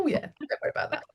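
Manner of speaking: breezy reassurance. Retold or imitated. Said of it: "I'll go".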